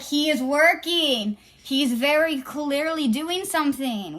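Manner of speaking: slow, loud insistence; exasperated, drawn-out correction; emphatic retort. Retold or imitated. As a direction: spell it out slowly and forcefully. Exasperated retort to the man, retold.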